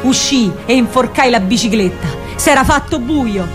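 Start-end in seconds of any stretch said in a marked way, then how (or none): none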